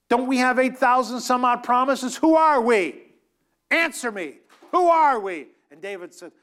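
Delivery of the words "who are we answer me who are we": loud, high "Who are we?" thrown at the crowd; demanding shout. Real, not acted. Preacher demands his congregation answer him, loudly.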